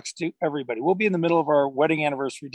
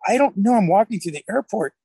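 raised pitch, louder indignant denial; retold protest; 6 semitones higher, 4 dB louder than usual. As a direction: protest innocence in a higher, louder retelling. Acted protest to accusing wife.

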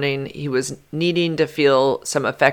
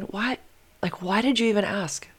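inner voice; 4 semitones higher, 8 dB quieter than usual. Retold or imitated. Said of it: "I'm in my head going".

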